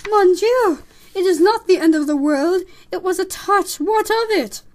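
high, very loud dramatic protest; exclaiming mother mouse. Acted. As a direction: protest loudly as an exasperated mother. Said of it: "Despereaux heard his mother's voice".

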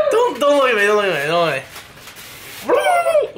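strained squeaky turkey voice; a silly turkey begging, drawn out slowly; turkey impression. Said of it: "do their best turkey impression. Here's mine".

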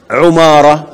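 عمارة with u after the ayn, umara; عمارة said as umara, ayn with damma. Shown as a demonstration. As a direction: open عمارة with a rounded u. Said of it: "بضم العين".

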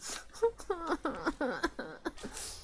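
high, soft laugh that falls away; high laughter fading. Real, not acted. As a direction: laugh helplessly at the game. Laughing near tears at the game. (risa aguda que se apaga)

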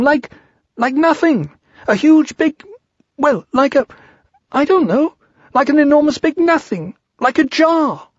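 very high, squeaky, halting stammer; panicked account. Acted. Piglet describing the Heffalump to Christopher Robin, high flustered panic. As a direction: squeal it, groping for the words.

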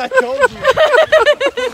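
loud laughing gloat. Real, not acted. Teasing, laughing loudly.

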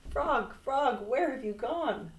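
high, urgent searching call; distressed cry. A narrator's reading. Toad searching for vanished Frog, crying out. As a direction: cry out for your lost friend. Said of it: "screamed Toad".

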